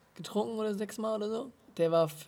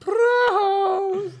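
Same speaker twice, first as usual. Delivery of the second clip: high, loud hammed-up cheers; exaggerated toast; 11 dB louder than usual. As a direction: raise the glass with a big shout. Toasting with water.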